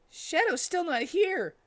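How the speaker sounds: high, slow whiny complaint; Tails whining. A narrator's reading. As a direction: pitch it way up, drawn out and fed up.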